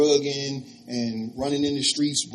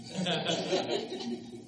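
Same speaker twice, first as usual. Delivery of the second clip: short laugh; 3 semitones higher, 7 dB quieter than usual. Retold or imitated. Laughter at the irony.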